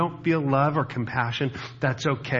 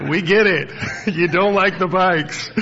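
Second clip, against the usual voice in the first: loud, laughing we-know reply; laughing friend; 6 semitones higher, 5 dB louder than usual. Retold or imitated. Patty teasing Jeff about bikes, loud and laughing. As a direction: tease him through laughter. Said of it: "she's like".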